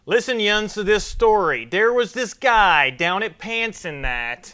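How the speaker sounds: loud, high, slow hammed-up Pittsburgh newscaster; Pittsburgh accent. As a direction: overdo the Pittsburgh accent as a terrible broadcaster. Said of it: "a broadcaster being like".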